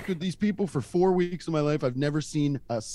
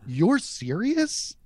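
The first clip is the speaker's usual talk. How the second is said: slow, higher mock-innocent question; feigned surprise; 6 semitones higher, much slower than usual. Storyteller reacting to his boss's concern, slow feigned disbelief. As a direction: draw it out as if just realising. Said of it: "like to pretend like I don't know why".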